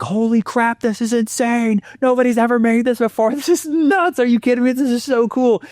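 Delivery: high, excited gushing; amazed sharks. Retold or imitated. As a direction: gush like stunned investors. Excited sharks voiced in story.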